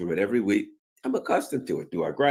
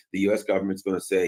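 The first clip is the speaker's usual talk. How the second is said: ordinary delivery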